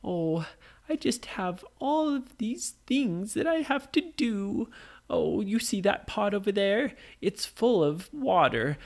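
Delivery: put-on high old woman voice fussing; old lady. Old lady asking Nora about the pot, high and fussy. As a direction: fret up high like a busy granny. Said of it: "the old lady said".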